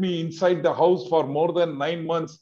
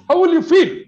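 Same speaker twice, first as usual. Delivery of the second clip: high, loud rhetorical plea; impassioned cry; 5 semitones higher, 7 dB louder than usual. Real, not acted. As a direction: throw the question at the crowd, pleading. Passionate appeal to the audience.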